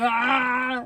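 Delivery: drawn-out mock exertion cry; imagine yourself groaning under a weight; imitated gym grunt. Imagining herself grunting at gym, mock cry. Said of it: "I hope I don't do that".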